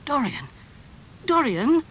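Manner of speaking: high, pained appeal by name; unhappy. A narrator's reading. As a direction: call to a friend in dismay. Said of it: "said Basil unhappily".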